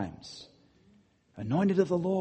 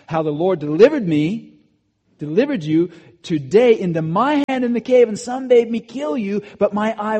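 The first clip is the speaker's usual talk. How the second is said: loud, higher preaching read; forceful scripture reading; 6 semitones higher, 9 dB louder than usual. Acted. Preacher reading David's words to Saul, loud and high. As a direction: proclaim the verse with full force.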